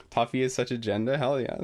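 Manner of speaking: normal talking voice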